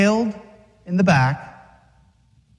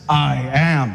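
swaggering Jesus; 6 semitones lower than usual. Acted. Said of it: "He had the man swagger too".

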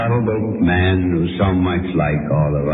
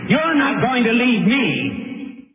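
very high, ringing command with long pauses; stern rebuke. Acted. Preacher voicing Christ rebuking Peter, high ringing sternness. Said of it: "our blessed Lord turned on him and said".

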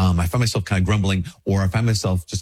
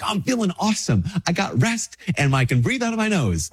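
much higher, gushing pretend reply; mock upbeat answer; 10 semitones higher than usual. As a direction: gush like a man who feels great. Imagined cheerful answer.

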